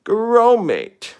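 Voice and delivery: high, loud call of the dog's name; Wallace impression. Shown as a demonstration. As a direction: sing out the name brightly. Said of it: "Wallace and Gromit".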